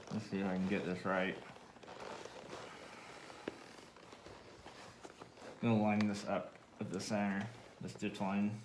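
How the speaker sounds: talking around nails held in lips; nails in mouth. Real, not acted. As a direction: speak with nails clamped in mouth. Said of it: "I'm gonna have a funny voice 'cause I have nails in my mouth".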